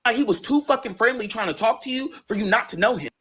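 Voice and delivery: normal talking voice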